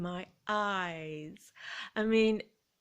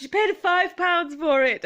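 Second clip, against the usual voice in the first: loud, much higher, slow astonished call; shouted across the shop; 9 semitones higher, 9 dB louder, slower than usual. Retold or imitated. The shop lady shouting at the speaker over the price, loud astonished call. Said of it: "she shouted across the shop".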